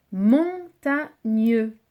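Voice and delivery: "Montagneux" stretched out very slowly, syllable by syllable; slow syllabified. Shown as a demonstration. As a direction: drag "Montagneux" into its three syllables. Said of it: "trois syllabes". ("Montagneux" très lent, syllabe par syllabe)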